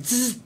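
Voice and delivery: high buzzing zzz for the sting; buzzing sting noise. Retold or imitated. Telling the scorpion fable, high buzzing sting sound. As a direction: make the scorpion's sting a quick buzz.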